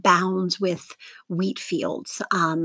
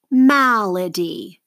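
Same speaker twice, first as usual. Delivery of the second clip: "Malady" slowly, MAL drawn out; stress on first syllable; 4 dB louder, much slower than usual. Shown as a demonstration. Teacher models for seventh graders to mark, slow stressed MAL in "Malady". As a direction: say "Malady" as MAL-a-dy, falling away steadily. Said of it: "circle the stress syllable".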